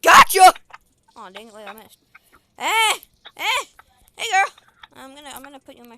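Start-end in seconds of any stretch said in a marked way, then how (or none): 0.0-0.5 triumphant shout; loud gleeful gotcha. Real, not acted. Catching someone in game. 2.6-4.5 loud, high hey; loud high call. Real, not acted. Calling out to character.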